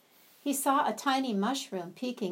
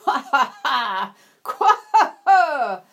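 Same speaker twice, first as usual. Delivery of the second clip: much louder booming frog guffaw; loud croaking laugh; 5 semitones higher, 13 dB louder, slower than usual. Acted. Frog laughs loudly at the animals from the mushroom, booming croak. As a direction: laugh big like a fat frog. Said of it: "Somebody laughed loudly".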